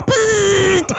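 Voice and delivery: slow high buzz; drawn-out electric zap; slow zapping bzzzt. Shown as a demonstration. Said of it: "In your case".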